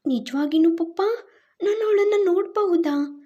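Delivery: put-on high, eager child's voice asking papa; a small girl thrilled to see her mother; excited little girl. Little daughter asking her papa, high and eager.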